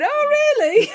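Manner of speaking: loud, very high incredulous squeal; sky-high, sarcastic surprise; mock astonishment. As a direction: react with hammed-up disbelief. Mock reaction on the test drive.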